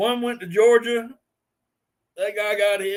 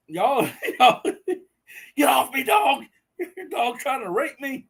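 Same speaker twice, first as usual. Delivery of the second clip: high, squeaky words through helpless laughter; laughing hard; 6 semitones higher than usual. Real, not acted. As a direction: act out the line while cracking up. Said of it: "I'm laughing so hard".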